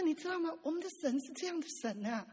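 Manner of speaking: usual speaking voice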